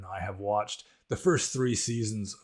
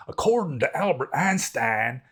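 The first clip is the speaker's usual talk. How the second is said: put-on Texas cowboy accent; 4 semitones higher, 5 dB louder than usual. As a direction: mimic the Texas cowboy scientist quoting Einstein, lightly. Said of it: "he's like a cowboy scientist from Texas".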